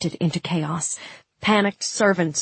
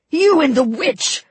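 high, loud, enraged yell; screamed accusation; 10 semitones higher, 5 dB louder than usual. Acted. Vasily turning on Nikolai before he charges, furious scream. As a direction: cry out in rage, voice pitched way up. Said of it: "he screamed at Nikolai".

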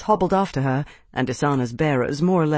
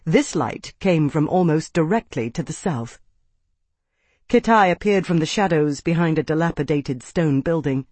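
ordinary delivery

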